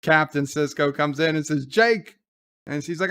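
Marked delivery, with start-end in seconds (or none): 1.7-2.2 high, loud retold call. Father comes in on his son, calling out. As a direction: call the name sharply upward.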